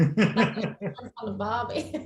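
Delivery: put-on slow laughing Aussie accent. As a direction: drag it out in a jokey Aussie voice. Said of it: "Give us your best Aussie accent".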